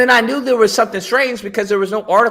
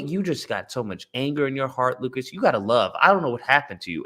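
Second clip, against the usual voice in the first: mock pious lecture; 7 semitones lower, 8 dB quieter, faster than usual. Mocking people telling him to love.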